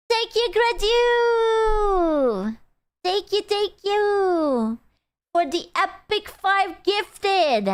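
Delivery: high, drawn-out cutesy thank-you; gushing thanks. Real, not acted. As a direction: squeal thanks slowly, stretching the last vowel high. Thanking for gifted subs.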